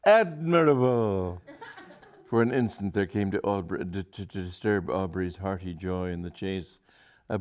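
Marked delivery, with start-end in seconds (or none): 0.1-1.4 excited. A narrator's reading. Charlotte thrilled by the plan.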